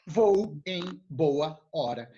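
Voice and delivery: "Vou em boa hora" said slowly, word by word; slow, spelled out. Shown as a demonstration. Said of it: "Vou embora quer dizer isso daqui".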